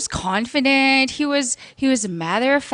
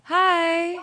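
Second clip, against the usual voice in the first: put-on girly voice; 5 semitones higher than usual. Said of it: "you got that girl voice perfect".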